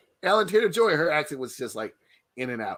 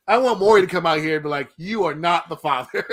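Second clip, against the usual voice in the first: excited; 6 semitones higher, 7 dB louder than usual. Pretend. Mock Maury reveal, laughing.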